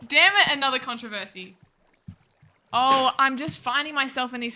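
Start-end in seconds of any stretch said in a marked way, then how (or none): 0.1-1.6 loud mock dismay. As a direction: exclaim the curse, then ease off. Mock dismay, repeated louder.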